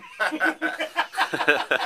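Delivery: loud, high, incredulous laugh; a sudden high cackle; high burst of laughter. Real, not acted. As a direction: laugh at the weirdness. Laughing at the odd offer.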